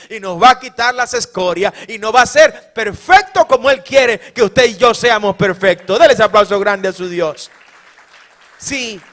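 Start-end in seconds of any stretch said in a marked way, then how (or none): 0.1-7.5 full-force preaching. Acted. Preacher whips the congregation into loud joyful praise. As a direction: preach it out to the rafters with joy.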